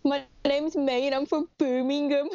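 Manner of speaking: high-pitched Birmingham accent; May's Brummie accent. Shown as a demonstration. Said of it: "say something in a Brummie accent".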